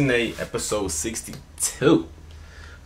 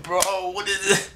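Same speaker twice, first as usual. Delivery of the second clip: baffled asking; 9 semitones higher than usual. Retold or imitated. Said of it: "I be like".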